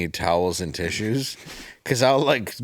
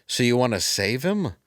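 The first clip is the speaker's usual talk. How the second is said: ordinary talk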